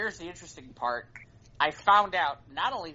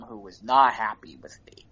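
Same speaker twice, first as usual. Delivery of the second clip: ordinary talk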